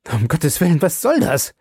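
high, alarmed outcry; cried out. A narrator's reading. Husband waking to find his wife bloodied, alarmed cry. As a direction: burst out in shock at the sight. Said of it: "schrie Harker".

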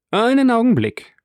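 high, loud interrupting call. A narrator's reading. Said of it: "rief Morcerf".

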